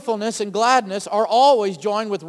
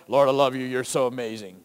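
low, dull croak with no joy in it; croaking worship; 9 semitones lower than usual. Pretend. Preacher mocks a joyless worshipper, low croak. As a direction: croak the praise out like it's a chore. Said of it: "You're just croaking at the Lord".

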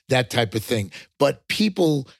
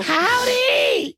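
very high, whooped Texas greeting; hammed-up high Texan cry; Texan howdy. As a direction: holler hello like a cartoon Texan. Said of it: "when two Texans see each other".

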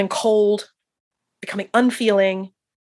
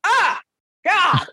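mock groan; 10 semitones higher than usual. Doctor disappointed she's the chaplain.

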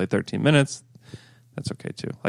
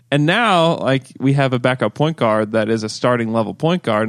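normal talking voice

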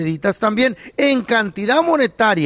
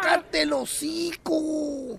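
soft, slow, higher hushed scold; hushed shut-up; 6 semitones higher, 10 dB quieter, much slower than usual. Retold or imitated. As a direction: hush him fast before the owner hears.

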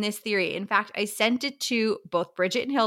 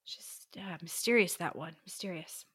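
hushed mysterious whisper; 3 semitones lower, 8 dB quieter than usual. Acted. Whispered secretive answer.

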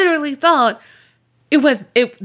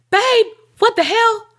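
high-pitched shocked outcry, acted; startled protest; 12 semitones higher than usual. Friend yelling from behind the bathroom door, startled. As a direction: imitate someone caught off guard.

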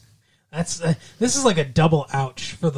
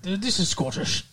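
put-on softer, lightly done Scottish accent; 6 dB quieter than usual. Host showing Carol what Scottish sounds like, mild Scottish accent. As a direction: give a quick Scottish accent sample. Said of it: "Scottish would be like".

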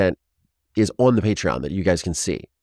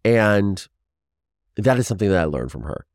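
ordinary talk, nothing marked

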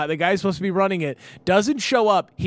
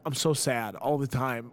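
mock sad; 4 semitones lower, 5 dB quieter than usual. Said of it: "I'm like".

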